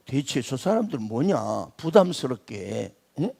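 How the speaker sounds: low, soft, put-upon muttering; mock grumbling thought. Preacher voicing a newcomer's uneasy thoughts, low grumble. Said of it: "이렇게 생각한 적은 없었습니까".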